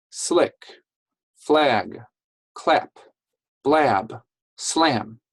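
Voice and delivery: short words at natural talking speed. Shown as a demonstration. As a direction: say each word quickly, as in normal talk. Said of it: "I'm gonna say them faster".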